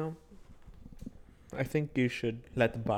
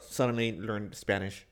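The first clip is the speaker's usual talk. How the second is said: ordinary talk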